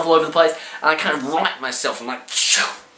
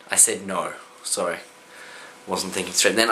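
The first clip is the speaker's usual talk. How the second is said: much lower, much softer aside; quiet aside; 9 semitones lower, 8 dB quieter than usual. Real, not acted. Correcting his own story, a soft low aside. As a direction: mutter the correction.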